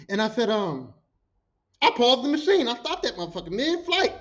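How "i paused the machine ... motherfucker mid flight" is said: ordinary talk, nothing marked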